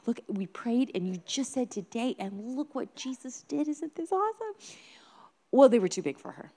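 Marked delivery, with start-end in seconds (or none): 0.1-4.5 higher-pitched, excited parent voice; enthusing parent. Retold or imitated. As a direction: share the wonder with a small child. Parents enthusing to small child.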